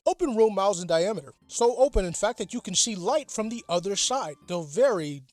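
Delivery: ordinary delivery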